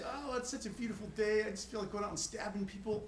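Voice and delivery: high sing-song cheer hiding a violent line; mock cheerful menace. Shown as a demonstration. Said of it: "If I say".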